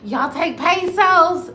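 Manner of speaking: slow loud y'all take pesos; pleading question. Retold or imitated. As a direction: stretch out pesos like a plea. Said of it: "looking like".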